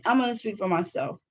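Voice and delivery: ordinary talk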